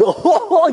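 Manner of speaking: high, goofy mock laugh; hammed-up overenthusiastic cackle. Shown as a demonstration. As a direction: laugh like an over-the-top goofball. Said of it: "you could be goofy as heck".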